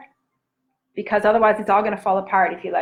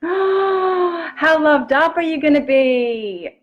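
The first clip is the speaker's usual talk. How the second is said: gasp, then a slow, high, louder cry of delight; gasping excitement; 7 semitones higher, 4 dB louder, much slower than usual. Real, not acted. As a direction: burst with wonder at the idea. Excited vision of self-love.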